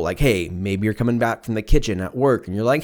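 usual speaking voice

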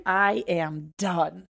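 drawn out, worn-out; slow exhausted. Retold or imitated. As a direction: say it slowly, totally drained. Exhausted declaration.

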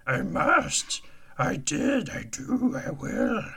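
high Yoda impression, inverted words; squeaky little alien master. Yoda voice continues.

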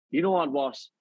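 normal talking voice

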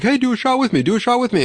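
high, pushy party-guest voice; high mock voice of partygoers pushing shots; coaxing partygoers. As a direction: egg him on like a drunk coworker. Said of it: "They're like".

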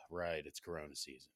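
mock-resigned kid, low and soft; resigned sigh. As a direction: sigh heavily, then give in under your breath. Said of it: "the sigh is the equivalent of".

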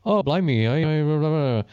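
a quick mock British talk-show voice; British accent. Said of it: "he shows up on a talk show and he's".

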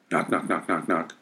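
ordinary delivery, nothing marked

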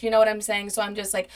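usual speaking voice

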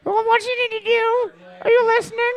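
put-on high old grandma calling. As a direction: nag like a grandma from another room. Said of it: "when your grandma's in the other room".

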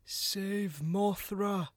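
very slow, drawn-out words; slow monster voice. Pretend. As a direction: stretch it out like a monster. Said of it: "Godzilla will say".

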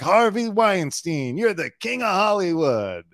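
slow, high, buttering-up reporter voice; flattering interviewer. Retold or imitated. Interviewer opening by flattering Harvey, slow and high. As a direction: stretch out the flattery, mock grand.

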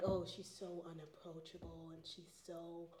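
a hushed, catty aside; gossipy murmur. Retold or imitated. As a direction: imitate people whispering judgments about her. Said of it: "a lot of people are like".

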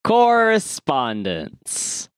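very slow, high, drawn-out announcer call; hammed-up jingle-style announcement; segment announcement. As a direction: stretch the word out, starting high and sliding down.

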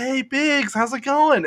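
bright greeting. Retold or imitated. Imitating Sierra's greeting.

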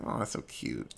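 whispered, soft and tender; gushing whisper; whispered aww. Retold or imitated. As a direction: breathe it like you're touched. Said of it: "I was like".

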